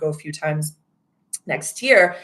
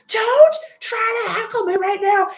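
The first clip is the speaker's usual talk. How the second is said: much higher, Boston-tinged mock yell; Boston came out; 6 dB louder than usual. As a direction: snap back in a high Boston rant. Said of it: "my Boston came out".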